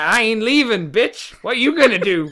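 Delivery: loud, high, defiant taunt; defiant tough girl. Retold or imitated. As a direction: shout the challenge up at him. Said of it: "She's like".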